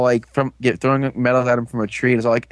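normal talking voice

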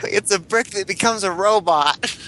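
put-on high, amazed little kid. Marvelling at a toy brick, high little kid voice. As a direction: pitch it up like an excited eight-year-old. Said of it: "It's my little kid voice".

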